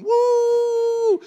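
long, high, very loud "woo"; imitated congregation cheer, held high and loud; crowd's shout. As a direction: whoop like a whole church shouting. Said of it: "everybody in the church is shouting".

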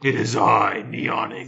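loud villain announcing himself; booming echoing villain monologue. Pretend. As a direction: proclaim your name like a video game villain. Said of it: "a villain on Tron".

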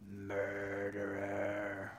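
very slow, low creature accusation; Gollum. Acted. Preacher as Gollum accusing Smeagol, low menace. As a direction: stretch the word out, low and soft. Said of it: "And Gollum keeps going".

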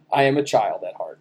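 normal talking voice

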